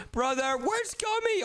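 high, drawn-out searching call; stretched, high-pitched urgent call. Acted. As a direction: call through the house for someone. Searching house for brother.

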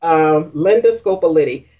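normal talking voice

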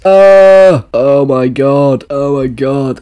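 long, loud groan sinking into dismay; appalled groan. Real, not acted. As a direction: groan it out slowly, aghast. Appalled reaction to what he read.

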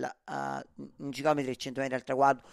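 normal talking voice

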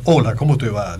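put-on low, gruff greeting; deep thick voice. Shown as a demonstration. Imitator demonstrating that anyone can fake it, deep voice. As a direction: drop your voice low for a mock deep hello. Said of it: "que hagas una voz gruesa".

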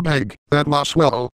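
ordinary talk

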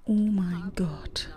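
slow, whispered oh my god; scared whisper. Real, not acted. Streamer frightened opening the door, slow whisper. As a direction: breathe it out in fright. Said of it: "Ich hab so Angst".

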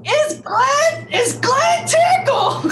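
very high mock-ecstatic shriek; hammed-up guest introduction. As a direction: squeal the name out, stretched and sky-high.

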